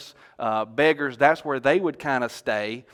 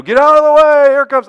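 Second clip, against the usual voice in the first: crowd shout; 13 semitones higher, 15 dB louder than usual. Acted. Bystander clearing the road as Jesus comes, shouting. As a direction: yell it out over a crowd. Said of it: "people were shouting".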